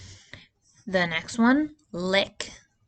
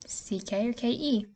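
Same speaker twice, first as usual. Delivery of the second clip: ordinary talk, nothing marked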